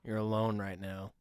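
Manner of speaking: low, disembodied inner voice; voice of consciousness. Pretend. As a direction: speak low as his inner voice. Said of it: "it's the voice of your consciousness".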